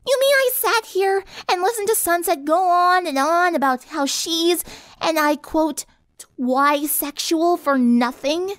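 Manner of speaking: high, indignant complaint; screechy outrage. A narrator's reading. Rainbow learning her guard duty was for nothing, outraged. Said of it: "Rainbow screeched".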